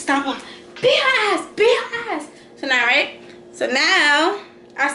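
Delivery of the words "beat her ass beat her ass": hyped cheering. Retold or imitated. Big sister cheers her sister on, high hyped yelling. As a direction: pump up your little sister mid-fight.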